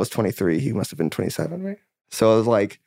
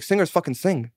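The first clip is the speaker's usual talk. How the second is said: higher, emphatic dad impression; insistent dad; 6 semitones higher than usual. Dad telling his kid singers sing, imitated insistence. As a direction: quote dad's motto with a pitched-up push. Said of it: "He's like".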